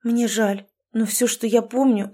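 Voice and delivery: usual speaking voice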